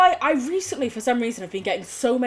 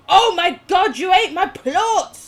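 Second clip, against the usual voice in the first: high, loud gamer outcry; outraged shout; 6 semitones higher, 7 dB louder than usual. Real, not acted. Player shouts at creature that ate the plot, outraged. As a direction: yell it in shocked indignation.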